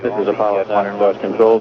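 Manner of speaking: ordinary delivery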